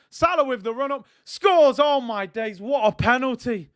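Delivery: real burst of joy at the penalty; excited shout. Real, not acted. Salah scores, commentator erupts.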